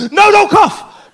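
high, loud, slow panicked cry; loud drawn-out mock alarm; alarmed shout. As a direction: stop him coughing in alarm. Shaman stops him coughing.

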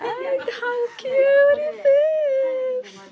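very high, slow squeal of delight; squeaky excited voice. Real, not acted. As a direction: squeal it with a laughing cry. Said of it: "Sorry for my squeaky voice".

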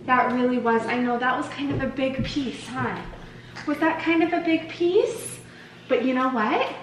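ordinary delivery

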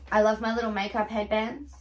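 ordinary delivery

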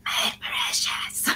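slow, soft raspy whisper; Gollum whisper. Pretend. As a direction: hiss it over the cheese. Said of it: "we jokingly use this phrase and the voice".

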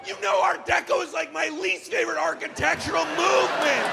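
high, loud exasperated rant; continued rant. Retold or imitated. Son ranting at mom about Art Deco, high and loud. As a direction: keep shouting at mom in disbelief.

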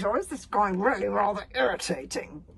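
ordinary delivery

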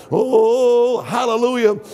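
ordinary talk, nothing marked

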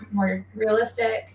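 ordinary delivery

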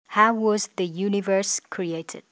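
slow, clear example sentence; slow example read. Shown as a demonstration. Teacher gives an example sentence slowly. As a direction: read it out carefully for the class. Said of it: "Ví dụ".

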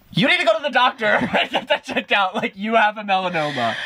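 high-pitched, urgent voice of online commenters; alarmed commenters. Acted. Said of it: "everybody in the comments was like".

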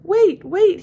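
high, loud cry to wait; yelling. A narrator's reading. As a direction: yell it out urgently after a departing bus. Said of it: "he yelled".

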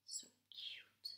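soft, far whisper; cooing whisper. Real, not acted. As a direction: whisper it adoringly. Aside about cute duck.

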